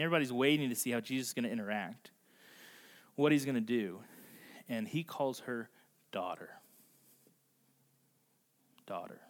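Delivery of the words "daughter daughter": low, hushed, letting the word rest; tender address. Retold or imitated. Jesus gently naming the outcast woman, tender and hushed. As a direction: say it softly and low, with weight.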